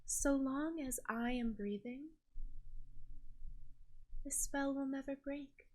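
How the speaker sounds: intimate enchantress. Acted. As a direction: draw close and promise it softly, with long pauses.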